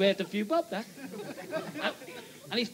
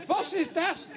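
higher, hammed-up German accent; mock German tourist; 8 semitones higher, 4 dB louder, slower than usual. German repeats his question at the door, hammed-up German accent. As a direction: play the German visitor big and comic. German retold with Liverpool twist.

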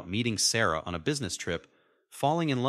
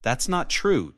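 loud, high-pitched denial; protest, voice lifted; raised-voice objection; 6 semitones higher, 5 dB louder, slower than usual. A narrator's reading. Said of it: "Dad protested".

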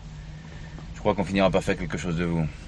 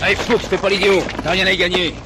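loud, higher shout across the street; calling out; 12 semitones higher, 8 dB louder than usual. Acted. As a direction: order him to give up, loud and firm. Commissioner calls to cornered gangster.

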